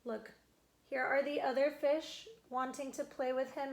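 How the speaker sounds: ordinary delivery, nothing marked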